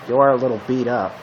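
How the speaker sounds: ordinary talk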